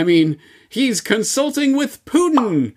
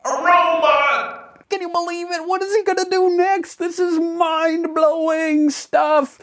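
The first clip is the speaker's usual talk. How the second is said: mock amazement; 7 semitones higher than usual. Fake awe at the robot.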